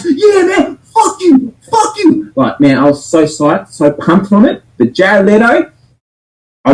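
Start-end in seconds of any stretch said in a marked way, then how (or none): 0.1-2.3 much higher shouting, reliving the thrill; reenacted hype shout at the TV; retold cheering. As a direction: yell it like you just jumped off the couch. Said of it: "I jumped out of the couch".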